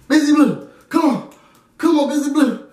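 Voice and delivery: high-pitched, insistent name-calling; urgent calling out. Retold or imitated. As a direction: imitate friends trying to rouse someone. Friends trying to wake drunk teller.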